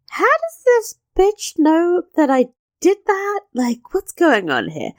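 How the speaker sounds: incredulous, shooting way up in pitch; mock disbelief. Anne looks at Lady Shelton, imitated in high-pitched disbelief. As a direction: play her baffled reaction in a high voice. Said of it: "Anne looks at Lady Shelton like".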